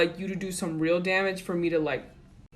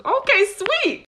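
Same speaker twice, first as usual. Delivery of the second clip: mock excited; 13 semitones higher, 6 dB louder, slower than usual. Reacting to cookie offer.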